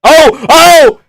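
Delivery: short, high, loud yelps; excited cries. Real, not acted. Fan reacting to fight action, loud excited cries. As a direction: yelp at a near finish.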